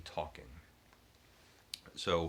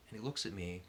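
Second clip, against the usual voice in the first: normal talking voice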